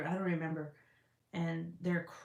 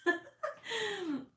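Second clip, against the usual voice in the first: high laugh. Real, not acted. Laugh after mock squeal.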